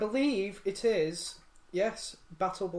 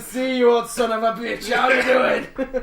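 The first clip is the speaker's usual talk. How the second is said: hammy buddy greeting; 4 semitones higher, 10 dB louder, slower than usual. Pretend. Mock greeting of a company.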